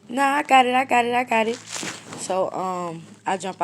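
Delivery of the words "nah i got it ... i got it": loud brush-off. Retold or imitated. Brushing off friend, insisting.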